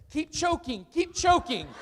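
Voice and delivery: mock nervous instructor blurt; nervous slip. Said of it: "and he says".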